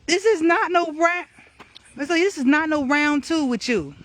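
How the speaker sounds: playful loud warning. Real, not acted. Telling the wasp, Mister Lee, to back off loudly.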